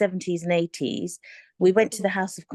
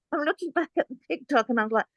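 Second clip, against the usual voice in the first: ordinary talk, nothing marked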